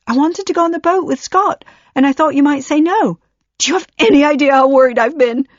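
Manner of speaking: high, loud, fast shriek of panic. Acted. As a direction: let it tear out of control, shrieking. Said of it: "She was shrieking".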